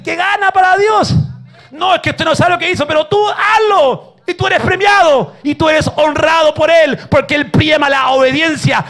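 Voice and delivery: loud, high preaching at full force; shouting sermon climax; fiery preaching. Acted. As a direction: preach it out to the whole church.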